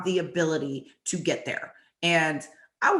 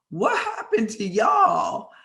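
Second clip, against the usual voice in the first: high, drawn-out incredulous question; mock bewilderment; 4 dB louder, slower than usual. Teammate stepping over flattened players, mock bewildered call. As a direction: call it out high and slow, playfully baffled.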